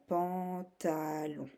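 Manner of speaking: "pantalon" slow, in three stretched syllables. Shown as a demonstration. Teacher showing the three syllables needed, syllable by syllable. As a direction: draw out "pan, ta, lon". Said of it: "tu as besoin de trois syllabes".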